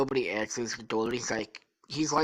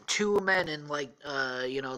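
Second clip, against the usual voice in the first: ordinary delivery, nothing marked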